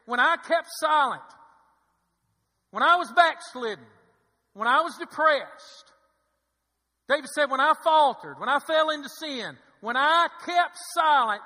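loud, high pulpit cry; impassioned scripture preaching. Acted. Preacher quoting David on keeping silent, loud impassioned preaching. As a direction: throw each clause out forcefully, with pauses.

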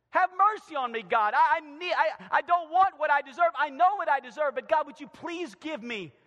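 loud, high, stumbling plea; crying out. Acted. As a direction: plead urgently with God. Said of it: "David cries out".

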